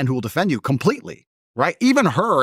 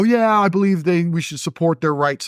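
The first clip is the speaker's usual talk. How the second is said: mocking straight people; 5 semitones higher than usual. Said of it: "sit there and go".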